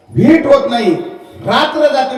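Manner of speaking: high, loud cry of "भेट होत नाही"; loud lament. Acted. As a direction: call out the grief. Preacher stresses the birds' grief. (मोठ्याने आक्रोश)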